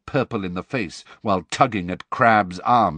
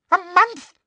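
much higher and louder shocked squawk; 6 dB louder than usual. A narrator's reading. Umbridge hears the potion takes a month, squawking. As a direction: squawk it out in outrage. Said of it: "squawked Umbridge".

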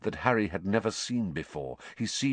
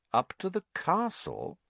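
silky; 8 semitones higher, 4 dB quieter than usual. Acted. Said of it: "said Snape silkily".

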